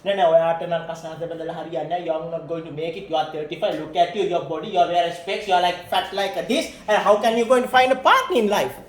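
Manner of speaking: fast, loud scolding; mock harsh counselor. Lecturer mocks a counselor scolding the client about her looks, fast and loud. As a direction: rattle off insults at the client.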